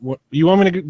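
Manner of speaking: ordinary talk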